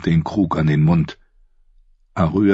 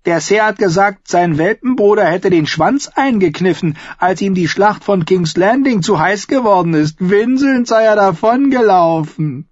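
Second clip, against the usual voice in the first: mocking squire; 13 semitones higher, 4 dB louder than usual. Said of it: "grinste den Bluthund blöde und spöttisch".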